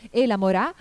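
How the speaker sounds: ordinary delivery, nothing marked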